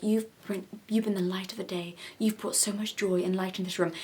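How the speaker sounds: mock-adoring hush; mocking gushing whisper. Vlogger mocking an interviewer, whispered gushing. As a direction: whisper it, sugary and fawning. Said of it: "So, like, to this interviewer".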